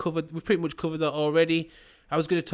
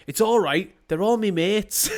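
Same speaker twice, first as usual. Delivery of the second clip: higher, louder lad voice through laughter; cheerful clueless lad; 6 semitones higher, 4 dB louder than usual. Retold or imitated. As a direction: play the oblivious lad, beaming. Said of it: "he turns around and goes".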